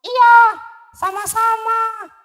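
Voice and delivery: a tiny creature being kind; high mouse voice. Acted. Mouse kindly answers the lion's thanks in a high voice.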